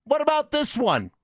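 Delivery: high, slow mock English reporter accent; hammed-up English accent, stretched out slowly; British reporter. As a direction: ham up a British journalist asking the question. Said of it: "He started out English".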